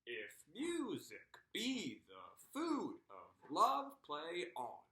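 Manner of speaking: slow "If music be the food of love, play on", pitch jolting up on every second syllable; exaggerated iambic stress. Shown as a demonstration. Teacher jolting head up on "If music be the food of love, play on", exaggerated iambic stress. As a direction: swoop up hard on each stressed beat of "If music be the food of love, play on", down between. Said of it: "just do it like this".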